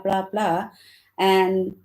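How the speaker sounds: ordinary delivery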